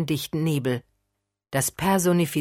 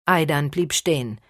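usual speaking voice

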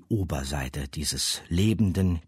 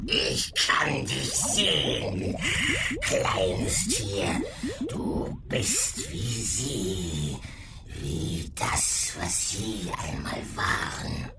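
slow, eerie creature voice; monster voice; 4 semitones higher, much slower than usual. Acted. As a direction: speak like a cruel beast.

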